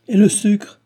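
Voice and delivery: high, loud exclaimed question; indignant retort. Acted. Baron retorting to his son about freeing slaves, indignant outburst. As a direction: burst out in outraged objection.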